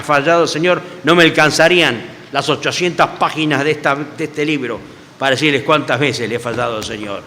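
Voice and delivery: normal talking voice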